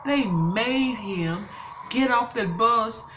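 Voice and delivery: loud, high, slow outraged shouting; furious outburst. Real, not acted. Grandmother raging to viewers that the driver left her grandson in the dark, furious shout. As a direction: yell out the injustice, dragging every word.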